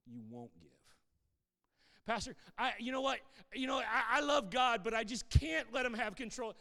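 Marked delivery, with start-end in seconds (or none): 2.0-6.5 a raised, louder whiny church member pleading; mock excuse-maker. The pastor mimics a church member making excuses, higher and louder. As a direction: play the congregant who claims he can't.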